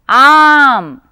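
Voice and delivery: आम with a long, loud, drawn-out aa. Shown as a demonstration. Teacher contrasting आम with अनार for the class. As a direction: hold the aa of आम long. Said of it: "क्या मैंने अम कहा?".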